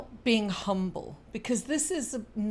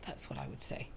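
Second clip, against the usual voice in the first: whispering; 12 dB quieter, faster than usual. Real, not acted. Quiet aside after the advice.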